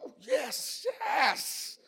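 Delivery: high, soft drawn-out yes; put-on delight. Husband reacting to wife's surprise roses, put-on delight. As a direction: draw it out high and hushed. Acts his sly reply to wife.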